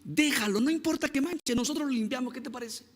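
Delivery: high, soft, coaxing reassurance; gentle pleading. Retold or imitated. Pastor asks the father to let boy paint, gentle pleading. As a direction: talk an upset parent down tenderly. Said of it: "yo le dije a su papá".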